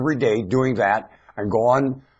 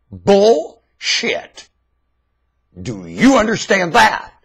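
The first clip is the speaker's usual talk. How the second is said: angry shout; 11 semitones higher, 11 dB louder, much slower than usual. Real, not acted. Ranter rejects the audience's excuse, angry shout. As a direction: yell it high and loud.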